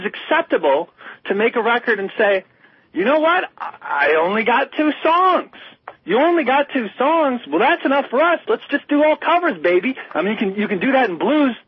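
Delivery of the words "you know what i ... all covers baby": higher-pitched, lightly acted musicians; imitated band banter. Imitating bandmates deciding to record covers, lightly acted banter. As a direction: play both sides of a band's chat.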